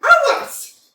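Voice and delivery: high, loud angry cry; shouted retort. Acted. Natalia shouting back that the meadows are hers, loud and angry. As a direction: top his shout with hers.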